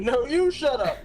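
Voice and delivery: high, swooping goat-style retort; mock shouted comeback. Friend guessing the dad's reply, playfully shouted. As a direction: toss back the movie quote, rising on "you".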